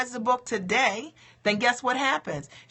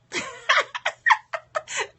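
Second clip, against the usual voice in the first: high burst of laughter. Real, not acted. Celebrates ordering the book.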